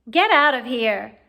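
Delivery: loud, slow angry shout at the cat. Acted. Ron shoves the cat away, shouting angrily. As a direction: bark it at the cat, furious. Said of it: "shoved Crookshanks angrily away".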